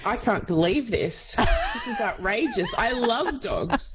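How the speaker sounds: playfully indignant, pitch leaping high; high-pitched, hammed-up indignation; mock outrage. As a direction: protest in exaggerated disbelief. Playful protest at dog slight.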